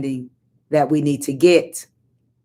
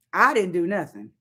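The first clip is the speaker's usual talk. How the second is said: ordinary delivery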